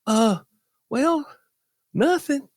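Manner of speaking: a hint of a guilty thief; sheepish thief. Voicing the caught thief.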